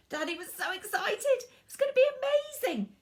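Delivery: excited. A narrator's reading. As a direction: squeal it out like an excited kid. Narrating Danny's excitement.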